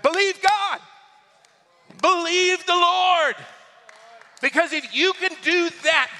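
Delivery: high, loud exhortation, near shouting; preacher in full force. Real, not acted. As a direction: cry it out to rouse the congregation.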